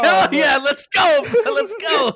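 high, loud cheering yell; hyped-up shouting. Acted. Fan cheering on the actor's battle cry, excited high shouting.

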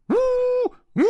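long high "wooo" hoots; sustained train hoot; train whistle. Acted. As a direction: imitate a locomotive whistle, held out. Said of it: "火车头叫了起来".

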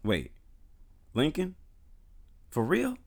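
rising, high disbelieving questions; wait, what, for real; shocked disbelief. Real, not acted. Shocked reaction to Lincoln.

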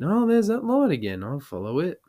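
put-on slurred, excited drunk voice lifted high; a drunk spotting a light; drunk bloke. Drunk patron marvelling at a light, high and excited.

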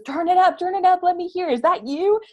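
the drive-through guy begging to hear it; excited. Retold or imitated. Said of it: "And he goes".

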